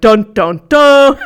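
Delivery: dramatic dun dun dun. Retold or imitated. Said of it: "it had the feeling of".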